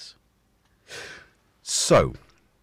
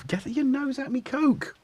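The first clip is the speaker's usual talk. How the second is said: much higher mock-scolding pet voice; scolding the cat. Real, not acted. Owner shoos the cat from his Coke, high and playful. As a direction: shoo the cat off the drink, playfully.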